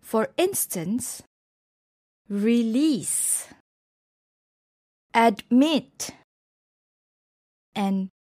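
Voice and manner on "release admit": reLEASE, adMIT, final beat stressed; stress on last syllable. Shown as a demonstration. Teacher showing verbs, last syllables stressed in "release", "admit". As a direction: lean on the end of "release" and "admit". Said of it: "the stress is on the last syllable".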